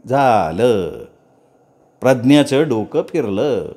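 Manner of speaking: ordinary delivery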